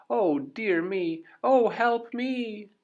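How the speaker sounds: high, loud, slow panicked wail; panicked cry. Acted. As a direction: squeal for help in sheer panic. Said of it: "called out in a panic".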